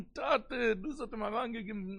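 high-pitched imitated plea; retold cry. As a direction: act out calling to father, without extra volume. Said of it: "אבער וואו זי האט געשריגן".

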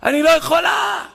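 mock shriek, high and loud; retold scream. Woman in labour screaming in the hospital, high retold shriek. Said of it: "היא נותנת צרחה".

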